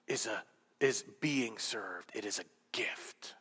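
unvoiced hush on the key line; whisper. Shown as a demonstration. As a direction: lean in and whisper the gift. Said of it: "Did you hear that last part?".

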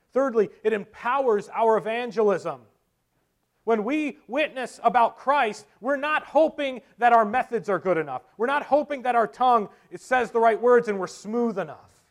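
loud, high, emphatic sermon voice; full-force preaching. Real, not acted. Preacher urging believers to witness about Christ, preaching forcefully. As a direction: hammer each clause out from the pulpit.

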